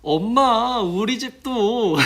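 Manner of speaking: put-on high, loud, slow kid call; excited child. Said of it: "애기들".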